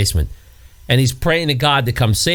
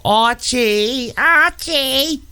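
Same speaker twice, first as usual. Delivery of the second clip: high, whiny Queens call; nasal whine; 12 semitones higher, much slower than usual. Shown as a demonstration. Jean Stapleton's Queens accent calling Archie, nasal whine. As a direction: whine it through the nose. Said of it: "It's nasally, whiny".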